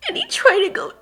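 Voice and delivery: put-on high, loud, crying child; sobbing account. Child telling her mother the brothers died, sobbing. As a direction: choke the story out through tears.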